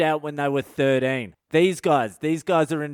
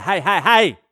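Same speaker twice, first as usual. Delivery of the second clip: loud, repeated heys cutting in; acted loud call to order; bossy interruption; 3 semitones higher, 7 dB louder than usual. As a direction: cut them off like a pushy boss. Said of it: "Everyone loves a boss like that".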